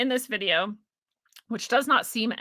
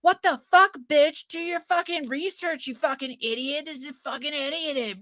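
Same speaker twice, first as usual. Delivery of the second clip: high, whiny mock voice of an angry commenter; mocking critic; 7 semitones higher than usual. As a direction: sneer it like a snotty troll parody. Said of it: "And to the fuckholes who are like".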